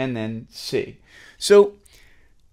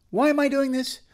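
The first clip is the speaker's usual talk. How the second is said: much higher-pitched questioning student voice; imagined doubting student. Pretend. Pupil questions teacher about the drill, high mock protest. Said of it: "And you're like".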